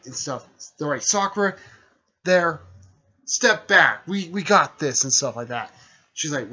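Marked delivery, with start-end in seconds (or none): 1.0-5.0 higher and louder, calling out to a teammate; confident shout. Retold or imitated. Naruto and Haruko tell Sakura to step back, raised voices. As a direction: shout her back out of danger.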